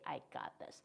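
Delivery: unvoiced whisper, trailing down; whispered reassurance. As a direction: whisper it softly to comfort.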